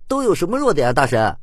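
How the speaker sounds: high, loud eager question; player calling out. A narrator's reading. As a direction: call up to the expert from the crowd.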